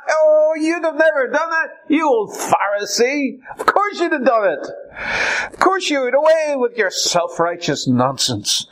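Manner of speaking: high, scornful mock reply to a Pharisee; mocking high retort; mocking rebuke. Preacher mocks objector.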